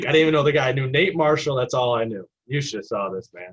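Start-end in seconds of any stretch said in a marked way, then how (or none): 0.1-2.3 louder, panicked protest; panicked retelling. Retold or imitated. New manager panicking to the listener, loud. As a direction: blurt it out in alarm. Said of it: "I'm like".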